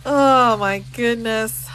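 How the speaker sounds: slow, breathless through laughter; laughing the words out. Real, not acted. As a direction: still giggling as she says it. Laughing at the cousin reveal.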